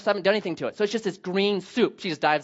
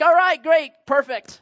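loud, higher, absent-minded yes; chirpy auto-pilot reply to wife; 7 semitones higher, 5 dB louder, slower than usual. Retold or imitated. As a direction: bark out a distracted agreement. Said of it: "I'm like".

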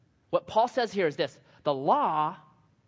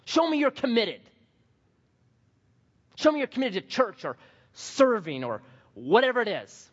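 high, loud mock demand; demanding legalist; 7 semitones higher, 4 dB louder than usual. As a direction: parody a pushy legalist, pitched up. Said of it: "and say".

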